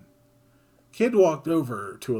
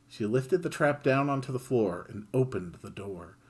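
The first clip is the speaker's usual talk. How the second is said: plain reading voice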